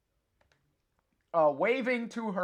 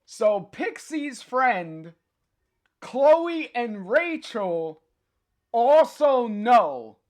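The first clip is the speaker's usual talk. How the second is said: slow, stretched words growing louder and higher; suspenseful build-up; 5 semitones higher, 6 dB louder, slower than usual. Pretend. Streamer teasing the comic's surprise to a viewer, suspenseful build-up. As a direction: draw each phrase out like a drum-roll reveal.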